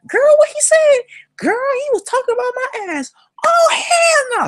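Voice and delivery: very high, loud falsetto yelling; mock outraged sisters. Sister hearing what he said, mock outraged. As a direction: wail it like two scandalized women.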